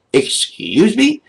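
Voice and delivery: high, slow, incredulous "Excuse me?" rising at the end; outraged disbelief; indignant excuse me. Real, not acted. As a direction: drag out "Excuse me?" in disbelief, pitch climbing. Indignant challenge to the moderators.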